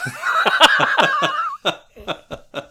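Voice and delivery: long high-pitched laugh; high laughter. Real, not acted. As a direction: break into laughter. Forgot it was live.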